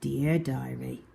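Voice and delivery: ordinary delivery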